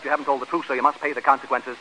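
normal talking voice

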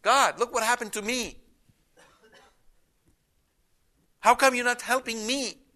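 whiny complaint. Pretend. Petitioner whining at God for help, complaining. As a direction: play the self-pitying petitioner. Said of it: "He's not saying".

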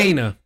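first syllable of Kena rhymes with "day"; correcting with KAY-nuh for Kena; correct pronunciation. Shown as a demonstration. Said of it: "pronounced Kena".

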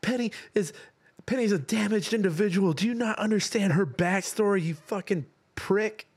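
high-pitched angry fan voice, hammed up; mock outraged fan, swearing; swearing tirade. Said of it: "some Stardew Valley fans that are like".

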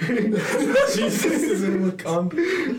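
high, slow, laughing through it. Real, not acted. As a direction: laugh the words out. Laughing reply.

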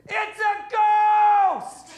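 very high, slow drawn-out shriek; hammed-up shrieking ghost panic; terrified disciples' shriek. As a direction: screech it like a terrified fisherman. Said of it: "I'm gonna help Sean here".

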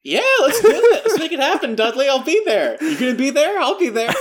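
hammed-up giddy flirt; mock swooning. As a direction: play the lovestruck fan calling out to Dudley.

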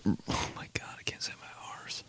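hushed, whispered dismay at a slip; under the breath, barely voiced; whispered aside. Real, not acted. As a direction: whisper it to yourself, mortified. Embarrassed aside after tripping up.

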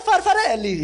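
high, silly fake-Italian gibberish; mock Italian. Comic showing God could be Italian, mock Italian accent. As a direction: toss out a cartoon Italian word. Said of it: "Si je veux qu'il soit italien".

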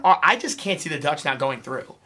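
usual speaking voice

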